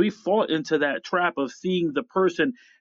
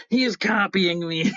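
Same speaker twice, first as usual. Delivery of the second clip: higher-pitched mock kid's gripe; childish complaint; 5 semitones higher than usual. Conversation between brothers as kids, mock complaint. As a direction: imitate a bothered little brother. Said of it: "the conversation between my brothers and I".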